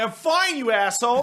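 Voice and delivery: put-on a kid insisting he's fine through the hurt; mock hurt retort. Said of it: "And they're like".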